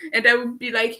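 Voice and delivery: usual speaking voice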